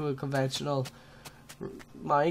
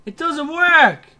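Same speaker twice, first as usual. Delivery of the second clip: loud, high, drawn-out mock crying; wailing dismay; 11 semitones higher, 11 dB louder, slower than usual. Real, not acted. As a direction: wail it out slowly. Plan fails, loud wailing.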